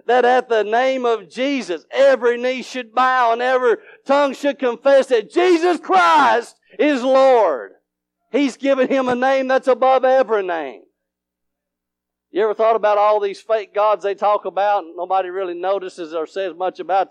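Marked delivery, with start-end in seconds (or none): 0.1-10.8 high scripture proclamation building to a shout; full-force preaching. Real, not acted. Preacher exalting the name of Jesus, full-force preaching.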